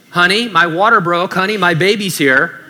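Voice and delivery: a pregnant wife calling out in surprise; excited wife. Pretend. Pregnant wife calls husband.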